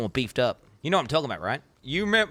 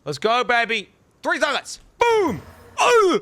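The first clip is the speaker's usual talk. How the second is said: much higher and louder, cheering the loot; real excited shout; 11 semitones higher, 6 dB louder, slower than usual. Real, not acted. Streamer cheering a lucky three-socket roll, excited shout. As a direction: yell it like you just hit the jackpot.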